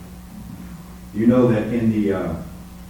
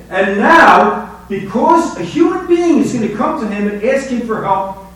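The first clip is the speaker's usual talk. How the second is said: rising, louder preacher's fervor; preaching at full force; 9 semitones higher, 8 dB louder than usual. Acted. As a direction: drive the sermon home, climbing higher and louder.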